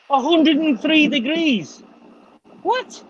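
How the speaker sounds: high, slow incredulous gasp of a question; stunned by the heat, voice jumping up; shocked disbelief. Real, not acted. Reacting to a hot forecast in high, slow disbelief.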